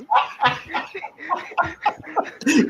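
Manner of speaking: high, hearty burst of laughter; big laugh. Real, not acted. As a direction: laugh hard at the friend's trash talk. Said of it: "I needed that laugh".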